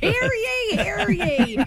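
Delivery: high, ringing town crier call. Acted.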